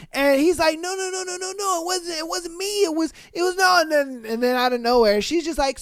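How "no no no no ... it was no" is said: high, quick, pleading no-no-no; scared and flustered, insisting; panicked denial. Acted. Guy denying, acted.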